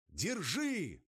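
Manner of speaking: loud, high shout in the wind; shouting over a storm. Acted. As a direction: yell it across the gale. Hauling rope in a storm.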